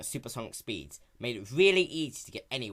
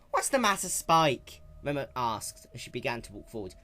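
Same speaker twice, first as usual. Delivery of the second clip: raised, brash tomboy pony voice; lightly raised young heroine; Rainbow Dash voice; 5 semitones higher, 4 dB louder than usual. Acted. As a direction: play her sporty and cocky.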